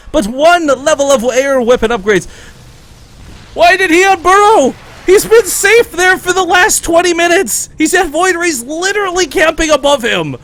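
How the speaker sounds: high, loud excited commentary. Real, not acted. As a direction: raise it with rising alarm. Caster excited alarm.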